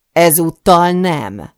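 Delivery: loud, drawn-out refusal. A narrator's reading. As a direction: refuse slowly and forcefully. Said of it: "emeli fel a hangját". (felemelt hangú, nyomatékos tiltás)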